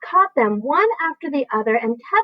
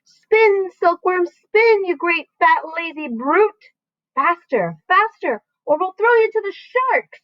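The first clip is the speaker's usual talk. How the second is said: high, loud bellowed orders; shouting; 8 semitones higher, 3 dB louder, slower than usual. A narrator's reading. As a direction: yell up from the depths at the lazy silkworm.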